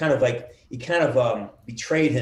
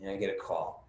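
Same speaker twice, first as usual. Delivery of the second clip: ordinary delivery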